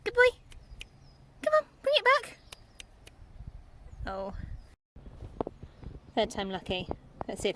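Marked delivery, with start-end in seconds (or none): 0.0-2.4 very high sing-song praise; dog praise. Real, not acted. Owner encouraging Ronnie, very high. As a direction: coo at the dog.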